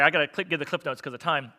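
ordinary talk, nothing marked

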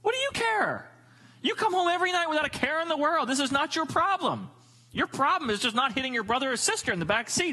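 high-pitched, mock-indignant dad; exasperated parent. Parent answers kid in the back seat, hammed-up exasperation. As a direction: scold a fretting kid in comic disbelief.

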